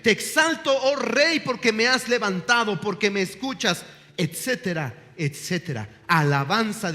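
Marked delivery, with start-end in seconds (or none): 0.0-3.8 high, loud, fervent praise; praise prayer. Shown as a demonstration. Preacher models opening prayer with praise, fervent and raised. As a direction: lift your voice in worship. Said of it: "Alabe su nombre".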